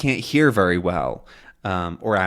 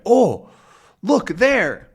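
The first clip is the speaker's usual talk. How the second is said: high, louder acted surprise; surprised; 12 semitones higher, 4 dB louder, much slower than usual. Tintin spotting something on deck, surprised. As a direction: exclaim at a sudden sight. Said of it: "He looks surprised".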